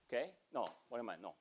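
usual speaking voice

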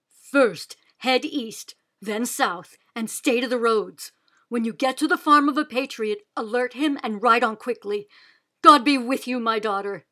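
hurried, earnest fatherly orders; urgent father. A narrator's reading. Father sends daughter off at night.

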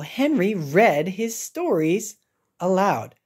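ordinary delivery, nothing marked

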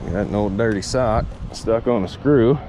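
usual speaking voice

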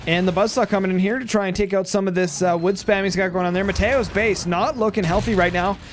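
ordinary talk, nothing marked

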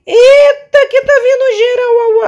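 high laughing shriek; panicked laughing scream. Real, not acted. Whole crowd charging at her.

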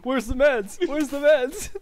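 high frantic mock panic; panicked enemy voice. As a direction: squeal it like a lost player. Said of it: "he's like".

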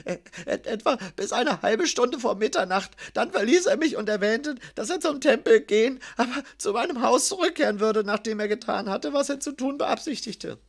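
high-pitched, stammering, anxious answer; frightened scribe. A narrator's reading. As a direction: stammer nervously under interrogation. Terrified scribe answers his interrogator. (ängstlich, mit hoher Stimme)

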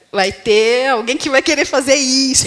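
a playful nudge that lands with extra punch; teasing warning to husband. Retold or imitated. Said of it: "falo pro Tadeu".

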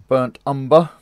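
straining at a tight lid; words pushed out with effort; opening a stuck jar. Real, not acted. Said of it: "Oh, I can't get the lid off".

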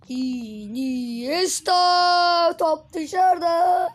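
slow, loud wail as the shot goes wide; drawn-out excited cry. Real, not acted. Gamer wailing as the shot goes out, slow and loud. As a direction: stretch every syllable in dismay. Chance then ball goes out.

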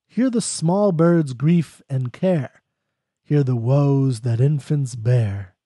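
slow, measured poem recital; poem reading. Acted. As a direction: read each line slowly, letting it settle. Reads Blake stanza slowly.

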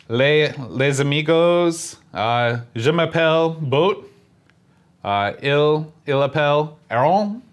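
halting French accent, slow; a halting show intro in broken French, on request; clumsy French. Shown as a demonstration. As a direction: fumble through a French intro. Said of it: "Say, like, ... Do your shtick in French".

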